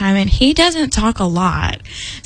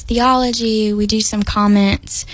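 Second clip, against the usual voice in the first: ordinary delivery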